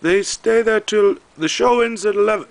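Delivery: high, loud imitated Filipino accent; heavy Filipino accent. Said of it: "very heavy Filipino accent".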